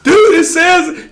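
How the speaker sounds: high, loud, slow, laughing through it; laughing panic. Retold or imitated. Young coach laughing at his own mistake. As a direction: laugh helplessly while explaining.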